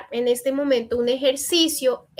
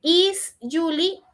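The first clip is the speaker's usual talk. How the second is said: slow corrected question; 5 semitones higher, slower than usual. Shown as a demonstration. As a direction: model the corrected question slowly. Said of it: "Sin la an". (pregunta corregida, lenta y marcada)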